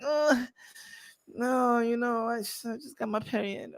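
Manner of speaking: soft, halting excuse; lightly voiced girl, quietly backing out; reluctant girl. Girl saying she got her period, soft and reluctant. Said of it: "And then she like".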